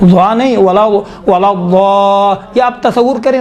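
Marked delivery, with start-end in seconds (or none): 0.8-2.3 "ولا الضا-" slow, long held ā, cut before the end; demonstrating ض. Shown as a demonstration. Teacher isolating the ض for students, slow and held. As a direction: stretch the heavy ض of "الضا-" slowly. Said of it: "دال جیسا ادا ہو رہا ہے".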